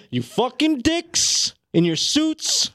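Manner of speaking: high, slow, furious Italian-style tirade; mock Italian rage. [name] cursing the suited execs, hammed-up Italian fury. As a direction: explode like an offended Italian tough guy. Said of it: "Go, [name]".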